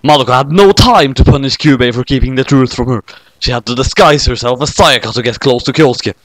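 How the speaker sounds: ordinary delivery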